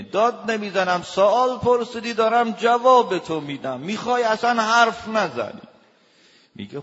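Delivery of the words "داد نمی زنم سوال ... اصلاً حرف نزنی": much higher, much louder, denying he is shouting; husband shouting back. Retold or imitated. Husband yelling at his wife that he isn't yelling. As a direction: bellow it back while insisting you are calm.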